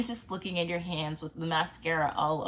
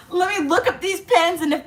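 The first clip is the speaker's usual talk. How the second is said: giggling, higher and louder; laughing; 11 semitones higher, 7 dB louder than usual. Real, not acted. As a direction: laugh through it at your own silliness. Giggling about Pinterest sign.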